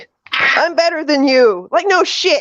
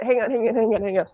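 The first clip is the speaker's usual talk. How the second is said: quiet self-prep; 5 semitones lower, 8 dB quieter, faster than usual. Real, not acted. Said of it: "let me see if I can do it".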